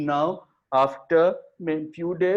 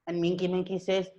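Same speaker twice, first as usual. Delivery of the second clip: ordinary delivery, nothing marked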